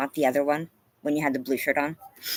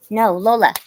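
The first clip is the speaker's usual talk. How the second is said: firm no; 5 semitones higher, 8 dB louder than usual. Real, not acted. Scolding the dog.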